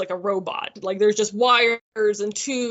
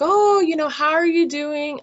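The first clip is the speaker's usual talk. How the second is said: a high, lightly voiced sweet nurse, lifted and a bit louder; imitating the nurse; 7 semitones higher, 4 dB louder than usual. Nurse phoning a patient at two in the morning, mock chirpy.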